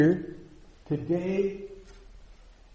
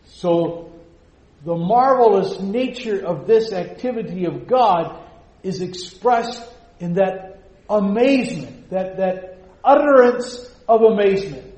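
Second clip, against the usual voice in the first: louder, higher, rising in awe; fervent preaching; 6 semitones higher, 8 dB louder than usual. Acted. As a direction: preach it with mounting wonder. Preaching climax on God's mercy.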